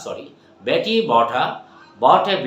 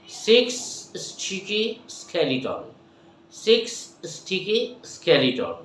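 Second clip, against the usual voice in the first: slow, careful twister; slow demo; 5 semitones higher, slower than usual. Shown as a demonstration. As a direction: spell out each sound slowly.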